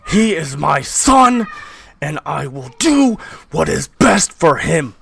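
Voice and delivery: high, slow, shouted declaration; furious domineering father; arguing father. Acted. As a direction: roar it at your wife, hammering key words. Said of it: "you hear the, like, argument".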